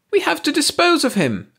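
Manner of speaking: very high, piercing shriek. A narrator's reading. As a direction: shriek it in a panic. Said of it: "Ursi shrieked".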